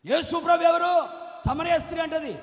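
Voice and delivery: loud, high preacher's shout; full-force sermon cry; fiery preaching. Acted. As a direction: thunder it from the pulpit.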